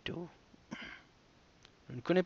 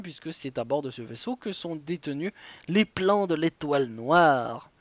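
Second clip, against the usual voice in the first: ordinary talk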